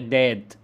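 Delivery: داد with d at both ends and a long aa; child's داد, d replacing w; random sound change. Shown as a demonstration. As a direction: say داد as a child's garbled word. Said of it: "يغير أصوات بدون نمط واضح".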